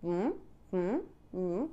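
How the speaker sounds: humming with closed lips; muffled hum, lips held; lips held shut. Shown as a demonstration. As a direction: try to speak with lips pinched. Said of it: "তুমি ঠোঁট ধরো".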